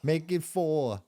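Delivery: high, slow mocking drunk voice ordering shots; a hammed-up party drinker asking for shots. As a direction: tease him by playing the barfly ordering. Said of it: "You're going".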